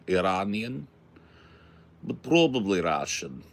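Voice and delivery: a hint of a Russian comrade's accent; mild mock Russian accent. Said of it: "Is my Russian accent so good".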